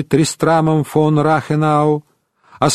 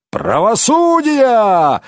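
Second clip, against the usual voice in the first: slow drawn-out shout of Правосудия; shouted cry; 7 semitones higher, slower than usual. Acted. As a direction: cry out Правосудия slowly, demanding. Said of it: "выкрикнул один из барутов". (медленный требовательный выкрик «Правосудия!»)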